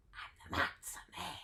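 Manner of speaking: hushed whisper. Acted. Matzo man whispering his name to the listener. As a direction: drop to a secret whisper on the last words.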